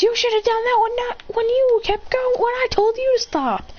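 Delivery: put-on very high scolding girl voice. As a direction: tell him off sharply, high. Naruko scolding him.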